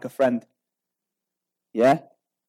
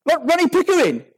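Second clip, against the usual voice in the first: high, indignant insistence on the name; Ronnie Pickering; 10 semitones higher than usual. Acted. Road-rage driver repeating his name, exasperated mock outrage. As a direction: insist as if everyone should know.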